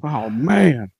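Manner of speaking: usual speaking voice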